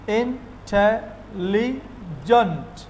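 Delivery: इंटेलिजेंट drawn out slowly, each syllable apart. Shown as a demonstration. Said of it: "तो इसमें कितने सिले". (इंटेलिजेंट को धीरे धीरे सिलेबल में तोड़कर)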